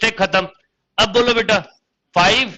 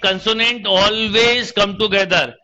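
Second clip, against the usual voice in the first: slow dictation; much slower than usual. Acted. Teacher dictating question.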